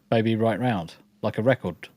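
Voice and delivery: ordinary talk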